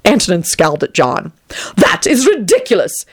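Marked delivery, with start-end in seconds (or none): 1.8-3.0 haughty. A narrator's reading. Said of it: "he intoned haughtily".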